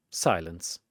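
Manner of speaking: ordinary delivery, nothing marked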